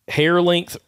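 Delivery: ordinary talk, nothing marked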